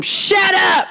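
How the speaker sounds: high sharp mock yell of shut up; imitated shout. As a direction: bark it like a fed-up teacher. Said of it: "By telling the whole classroom".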